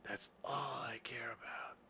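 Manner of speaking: hushed whisper; whispered character voice. Shown as a demonstration. Game master's Zinc repeats what he cares about, whispering. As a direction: sink into a whisper for the motto.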